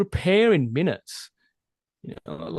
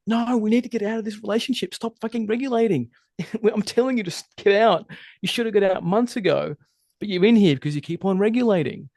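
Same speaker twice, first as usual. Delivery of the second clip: higher-pitched, urgent mock protest; plead, exasperated, as the body overruling the head; insistent body's voice; 6 semitones higher than usual. Body tells you to get out of this relationship, urgent protest.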